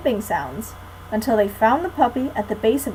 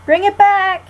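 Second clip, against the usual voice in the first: loud, high call after the puppy; 8 semitones higher, 7 dB louder than usual. A narrator's reading. Annie calling after the runaway puppy. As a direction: call across the yard. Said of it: "Annie called".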